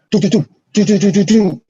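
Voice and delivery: rapid high "do do do" gunshot noises; imitated gunfire. Telling a friend about the shooting, rattling off mouth gunshots. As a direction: mimic the shots going off down the block. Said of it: "as they're shooting at the car".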